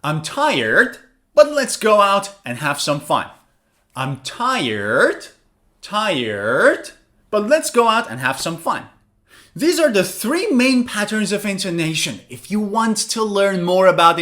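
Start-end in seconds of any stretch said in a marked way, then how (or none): 0.0-8.9 "tired" dipping then rising, then "fun" closing the sentence; fall-rise. Shown as a demonstration. Teacher giving another example, "tired" falling then rising. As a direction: swoop "tired" down and up to sound unfinished. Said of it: "This is the fall, rise intonation".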